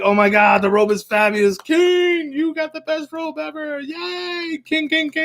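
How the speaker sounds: very high, gushing fake cheer; cheering crowd. Crowd pretending to admire the robe, very high fake cheering. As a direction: cheer the king like a fawning crowd.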